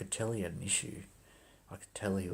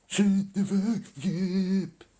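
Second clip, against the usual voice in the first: mock angry yell; 12 semitones higher, 13 dB louder, much slower than usual. Clerk's yell imitated.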